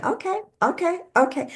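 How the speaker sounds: high, far-off meek okays; body voice giving in. Retold or imitated. Body answering the mind's insults, high meek giving in. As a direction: voice the body meekly caving in. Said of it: "your body's going".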